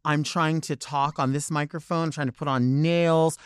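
usual speaking voice

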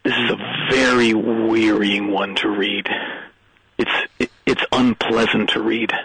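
lightly voiced, low, weary voiceover; a hint of an old actor grumbling over a script; Orson Welles impression. Kid as Orson Welles complaining about the read, low and weary.

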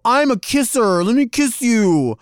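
pushy kisser fan. Retold or imitated. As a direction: sing it out like an overeager hugger. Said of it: "walking up to somebody and go".

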